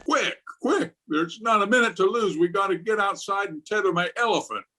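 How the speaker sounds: high, fast, frantic urgency; flustered alarm, pitched high and rushed; panicked rush. Acted. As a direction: hurry everyone out in a flap. Panics over radio warning.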